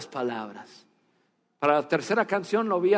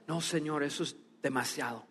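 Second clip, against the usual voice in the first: hushed, lower, much softer refusal; a humble man quietly turning down the tip; soft humble protest; 5 semitones lower, 13 dB quieter than usual. Retold or imitated. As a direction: let him shrink from the gift, almost murmured.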